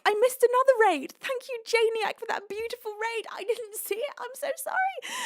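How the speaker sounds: high-pitched, emotional gushing; flustered apology. Real, not acted. Streamer apologising to Janiac for missing raid, flustered. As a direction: climb into an overwhelmed high wail.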